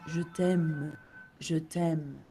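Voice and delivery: "Je t'aime" in a French accent, low and soft; French-accented "je t'aime", quietly demonstrated. Shown as a demonstration. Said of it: "say it with a French accent".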